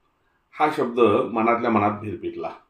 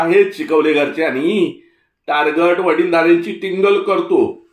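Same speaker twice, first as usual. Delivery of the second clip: put-on higher, louder grumbling old man; an old man fuming about rude kids; shrill Malvani scold; 7 semitones higher, 5 dB louder than usual. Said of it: "चिरका Malvani हेल".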